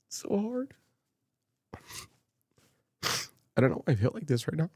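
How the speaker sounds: choked up. Real, not acted. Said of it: "I'm getting more choked up now".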